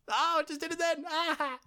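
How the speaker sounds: high shocked self-catch; surprised squeal. Real, not acted. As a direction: squeal at your own slip.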